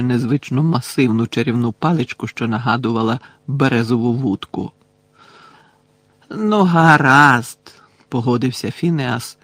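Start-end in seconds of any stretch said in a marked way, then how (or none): 6.3-7.6 drawn-out, grudging consent; reluctant agreement. A narrator's reading. Phineas eyeing the witch's wand, slow reluctant agreement. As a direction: give in slowly, stretching the words. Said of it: "боязко поглядаючи на чарівну паличку".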